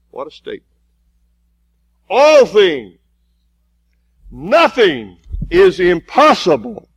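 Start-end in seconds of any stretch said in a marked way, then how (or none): 2.1-6.9 slow, loud, emphatic preaching, building in power; full-force preaching. Acted. Preacher driving the congregation toward faith, full force. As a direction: preach it out, hammering each phrase.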